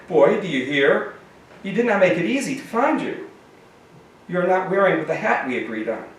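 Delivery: a high, fussy scold with an edge; effeminate but mean. A narrator's reading. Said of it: "his tone effeminate but mean".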